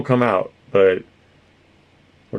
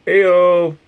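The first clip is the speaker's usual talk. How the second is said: mock Jersey accent; 8 semitones higher, 4 dB louder than usual. Trying out East Coast accents.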